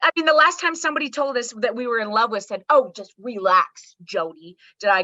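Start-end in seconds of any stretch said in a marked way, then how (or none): 2.7-4.6 slow, drawn-out mock advice; imitated dismissive partner. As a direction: draw out "relax" as a partner would. Retells partner telling her to relax.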